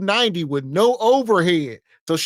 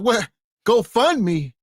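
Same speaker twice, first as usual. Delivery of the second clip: mock disbelief, pitched up; higher, astonished echo of the answer; incredulous interviewer; 6 semitones higher, slower than usual. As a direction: imitate a baffled interviewer questioning the answer. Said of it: "They gonna be like".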